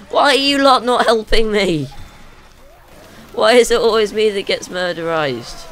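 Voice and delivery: exasperated shout. Real, not acted. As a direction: yell in outrage at useless teammates. Player rants at unhelpful teammates while attacked.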